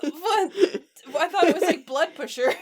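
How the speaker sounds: giggling through words; laughing. Real, not acted. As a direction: laugh while talking. Laughing at pump biscuit.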